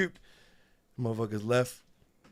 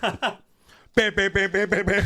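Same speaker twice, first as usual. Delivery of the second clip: high, louder bam bam bam; imitating beat noises; 9 semitones higher, 4 dB louder, slower than usual. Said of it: "This dude was like".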